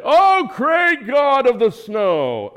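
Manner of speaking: high, slow, hammed-up invocation; mock worship. Preacher mocking his own ski-trip worship, grand and slow. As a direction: intone it like a mock pagan priest.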